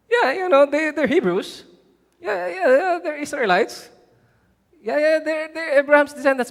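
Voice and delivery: high, offhand "yeah, yeah"; mock dismissive concession. Dismissive concession of rivals' claims.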